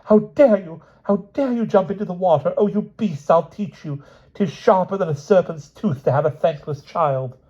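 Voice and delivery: very high, furious tirade; the queen berating her sons; raging queen. Acted. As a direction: scold the boys with venom.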